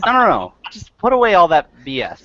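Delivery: high, loud mock protest; mock exasperated player. DM imitates players telling him to drop his prep, loud and mocking. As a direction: ham up a fed-up player waving it off. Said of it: "they're just like".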